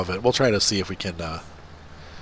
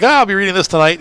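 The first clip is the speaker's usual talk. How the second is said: higher excited quote; 10 semitones higher, 7 dB louder than usual. Retold or imitated. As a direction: gush like a happy reader. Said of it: "I got all excited".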